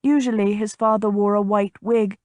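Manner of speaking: plain reading voice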